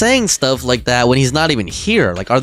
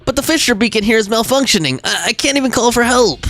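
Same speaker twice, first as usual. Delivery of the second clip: pleading Bangboo, pitched up; anxious little helper; 5 semitones higher than usual. Acted. Bangboo begging rescue squad to save its master, anxious. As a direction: plead for help in a higher, worried voice.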